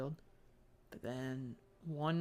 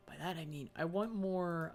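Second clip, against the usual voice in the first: ordinary talk, nothing marked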